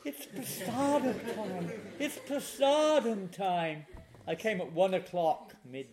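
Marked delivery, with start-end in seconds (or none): 0.1-3.8 high, slow announcement; singsong imitation of a hostess; welcoming devotee. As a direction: call it out high and drawn-out, imitating her. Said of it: "Then she said".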